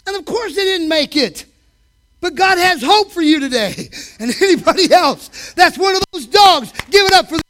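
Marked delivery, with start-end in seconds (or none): none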